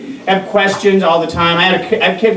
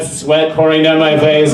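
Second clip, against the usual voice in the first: normal talking voice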